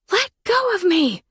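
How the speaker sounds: high, loud scream of panic; woman screams for security to hear. A narrator's reading. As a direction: scream to be let go. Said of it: "I screamed".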